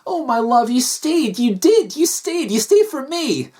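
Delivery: high, gushing woman character; overjoyed Kathy. Acted. Wife thanking husband for staying, overjoyed.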